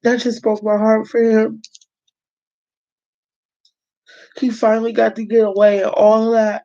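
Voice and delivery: speaking through leftover tears; tearful. Real, not acted. Telling viewers the story broke her heart, tearful. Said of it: "Now get it together".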